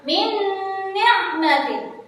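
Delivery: slow recitation, lingering on the nasal join of "Min ni'matin"; nasal merging (idgham). Shown as a demonstration. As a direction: chant "Min ni'matin" with a long hummed n. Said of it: "saya ulang".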